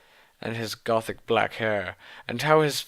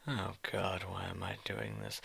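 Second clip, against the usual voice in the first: much softer, a weary real groan of disbelief; despairing aside; 3 semitones lower, 14 dB quieter than usual. Real, not acted. Reader despairing at the fanfic under her breath, very soft. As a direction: mutter it to yourself, done with this text.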